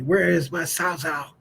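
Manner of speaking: slow, restrained echo of country dad; dad's country accent. Retold or imitated. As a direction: replay dad's West Virginia drawl without yelling. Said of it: "he was so mad, and he said".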